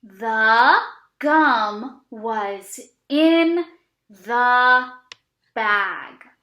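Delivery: slow, stretched word-by-word reading; teacher reads with kids while puppet points, slowly; slow read-along. Shown as a demonstration. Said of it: "Are you guys ready?".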